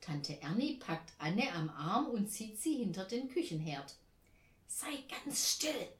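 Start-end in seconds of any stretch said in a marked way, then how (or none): 4.7-5.9 hushed, high whispering, slowing on the warning; urgent whisper. A narrator's reading. Aunt hiding with the child behind the stove, whispering urgently. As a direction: whisper so nobody outside hears. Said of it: "raunt sie".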